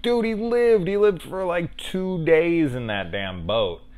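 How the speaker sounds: high, loud, delighted cry of disbelief; thrilled shout, slowing down at the end; excited disbelief. Retold or imitated. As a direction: yelp it like the fan who can't believe he survived. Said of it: "you're like".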